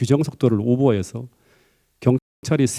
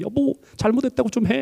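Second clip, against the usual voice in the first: higher, earnest imitated plea to her husband; wife imploring husband to apologize, mild imitated plea; pleading wife; 10 semitones higher than usual. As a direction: beg him gently to apologize. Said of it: "간곡하게 부탁하면".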